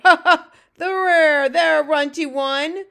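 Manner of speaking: loud, high-pitched mocking giant; booming laugh into a drawn-out taunt; Fleshlumpeater, jeering. A narrator's reading. Bully giant taunting the runty one, jeering loudly.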